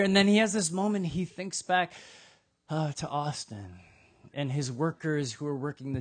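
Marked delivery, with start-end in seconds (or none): none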